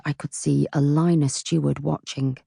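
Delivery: plain reading voice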